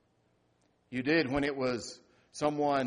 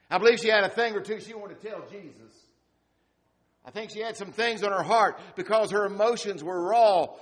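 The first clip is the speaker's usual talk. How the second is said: louder, higher preacher's build; preaching at full force; 8 semitones higher, 8 dB louder than usual. Acted. Pastor preaching to the grieving congregation, full force. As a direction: drive the point home from the pulpit.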